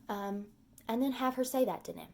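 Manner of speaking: ordinary talk, nothing marked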